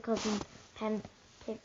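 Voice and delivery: usual speaking voice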